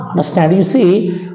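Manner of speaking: normal talking voice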